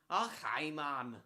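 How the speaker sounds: put-on casual young Geordie greeting, barely shifted; Jack voice. Streamer auditions his Jack voice for Robert, lightly voiced Geordie lad. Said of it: "can I just do my Jack voice for, uh, for Robert?".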